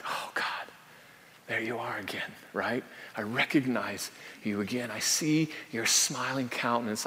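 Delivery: soft, breathy hush of recognition; hushed awe. Retold or imitated. Believer quietly greeting God again in hushed awe. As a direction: whisper it like finding a lost friend.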